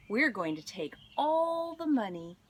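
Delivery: normal talking voice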